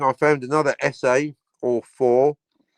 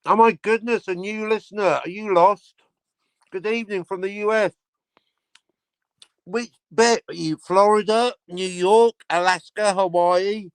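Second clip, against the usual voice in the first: mock excited welcome; 6 semitones higher than usual. Real, not acted. Greeting new listener.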